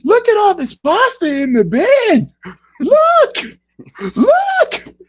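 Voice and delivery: high squeaky climbing look, look, look; Gordon Ramsay rant. Retold or imitated. Imitating a chef mocking wasted pasta, hammed-up. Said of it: "my favorite one is this one".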